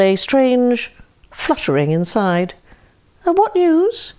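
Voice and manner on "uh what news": hiding fear. Acted. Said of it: "trying not to show that he was afraid".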